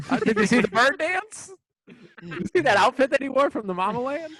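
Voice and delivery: loud high laughing questions; laughing mockery. Real, not acted. Friends mocking Furio's outfit, loud high laughing teasing. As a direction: rib him through laughter.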